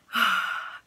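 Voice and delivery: a long, heavy sigh before going on; deep sigh. Real, not acted. Mother bracing herself to retell her labor, heavy sigh. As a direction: breathe out hard, bracing for the painful memory. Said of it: "I just need to take a deep breath".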